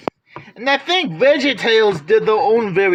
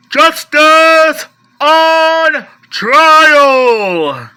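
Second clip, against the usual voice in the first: much higher, far louder, very slow booming announcement; trailer announcer; 7 semitones higher, 8 dB louder, much slower than usual. Pretend. Collector announces a Justice League title, epic trailer voice. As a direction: boom it out like an epic movie trailer.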